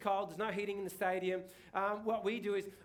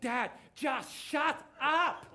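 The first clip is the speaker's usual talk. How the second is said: put-on slow, higher, louder exasperated kid voice; mock annoyed daughter; 5 semitones higher, 4 dB louder, much slower than usual. Daughter telling dad to stop yelling, mock exasperated. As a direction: play the embarrassed teenager shutting dad down. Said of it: "they make all these hand gestures".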